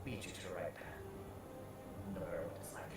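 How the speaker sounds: put-on soft, hushed old priest counsel; a lightly voiced kindly priest; gentle priest. Priest Boston blesses the player.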